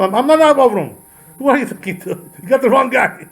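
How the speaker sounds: high-pitched, baffled protest; indignant patriarch. Acted. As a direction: insist they have the wrong person, hammed up. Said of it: "So he's gonna tell him".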